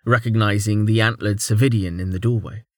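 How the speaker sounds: plain reading voice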